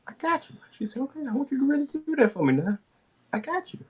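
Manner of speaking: very high, breathless falsetto; flustered squeak. Retold or imitated. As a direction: nervously agree in a squeaky voice.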